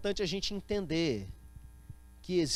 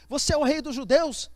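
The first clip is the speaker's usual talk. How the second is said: loud, high, incredulous question; acted interrogation; 7 semitones higher, 8 dB louder than usual. Pilate interrogating Jesus, loud and high. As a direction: fire the question out, raised and forceful.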